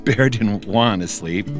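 plain reading voice

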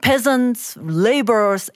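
ordinary delivery, nothing marked

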